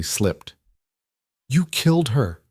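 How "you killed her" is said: higher-pitched accusation stated as fact; flat statement. A narrator's reading. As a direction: state it as fact, asking nothing. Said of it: "voice flat".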